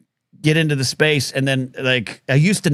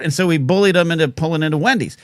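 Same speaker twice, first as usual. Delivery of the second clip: normal talking voice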